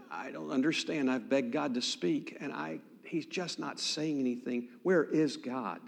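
soft, close-up confiding plea; hushed bewilderment. Retold or imitated. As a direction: plead quietly, face to face. Young man confiding forehead to forehead.

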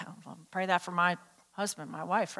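low, very soft aside; hushed, low congregant's aside; muttered wish. Acted. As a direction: murmur it like a sly confession. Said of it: "Some of you be like".

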